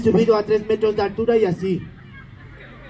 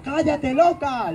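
mock heckle; 5 semitones higher, slower than usual. Shown as a demonstration. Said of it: "no le digas eso".

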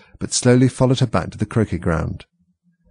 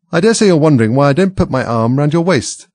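higher, louder Duchess voice; raised, pushy character voice; storybook Duchess; 6 semitones higher, 6 dB louder than usual. A narrator's reading. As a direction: pipe up as a forward Duchess. Duchess addressing Alice in dialogue.

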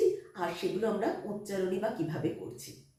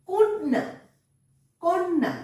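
কন্যা called out high and loud, ন doubled, কন্-না; emphatic model word; 9 semitones higher, 6 dB louder, slower than usual. Shown as a demonstration. Teacher calls out কন্যা, loud and high, for the children. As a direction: announce the word for the class to echo. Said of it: "model word".

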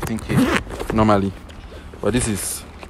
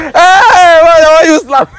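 very high, loud wail; loud howl of complaint after a slap; wailing protest; 15 dB louder, much slower than usual. Real, not acted. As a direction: cry out in aggrieved disbelief. Protests after being slapped.

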